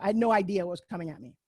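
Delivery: ordinary delivery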